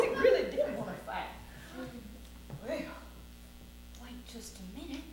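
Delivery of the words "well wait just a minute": very soft, held-back pondering; pensive second thought. Acted. Cowboy reconsiders fighting the lion, quietly pondering. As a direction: drop right down and mull it over.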